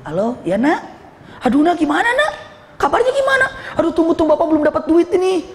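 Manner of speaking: high-pitched, anxious father on the phone; hammed-up worried pleading; put-on distress. Con man fakes a call from his child, distressed.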